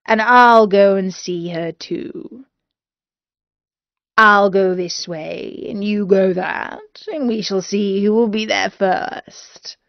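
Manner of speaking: lightly voiced scheming wolf, ending in a whisper; a wolf plotting to race her, dropping to a whisper; a hint of a cunning wolf, whispered at the end; sly wolf.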